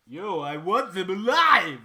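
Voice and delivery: loud slow Stallone-style yell; Stallone Vader shout. Pretend. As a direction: bark the order, slow and loud. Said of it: "Imagine Stallone as Darth Vader".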